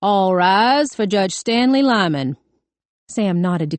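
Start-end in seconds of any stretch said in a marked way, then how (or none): 0.0-2.4 slow, drawn-out official call; court clerk. A narrator's reading. Clerk announces the judge's entrance, official call. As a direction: call the courtroom to its feet, slowly. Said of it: "The court clerk said".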